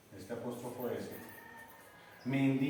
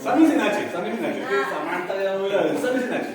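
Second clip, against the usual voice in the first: teasing laugh; 5 semitones higher, 9 dB louder than usual. Real, not acted. Teasing joke about Sammy.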